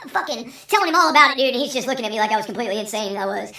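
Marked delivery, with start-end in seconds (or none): none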